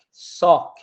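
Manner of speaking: Sok punched loud on its own; stressed final syllable. Shown as a demonstration. Teacher showing where the accent falls, Sok said loud and stressed. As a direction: isolate Sok and hit it hard. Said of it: "la acentuación está en la sok".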